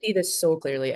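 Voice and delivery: ordinary talk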